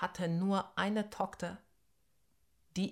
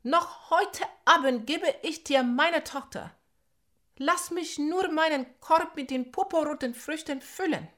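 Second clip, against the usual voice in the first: calling out; 10 semitones higher, 8 dB louder than usual. Acted. Nandi keeps calling to snake.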